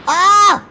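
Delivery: loud, high "ah!" scream; sudden yell. Pretend. Gamer seeing the key get away, sudden yell. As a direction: let out one sharp cry. Yells as item flies off.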